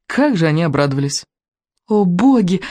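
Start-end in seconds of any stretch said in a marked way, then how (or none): 1.9-2.6 lightly sung-out cry of delight; singsong exclamation. A narrator's reading. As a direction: lilt it slightly, drawing out the vowels. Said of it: "пропела Камигадзе". (слегка нараспев)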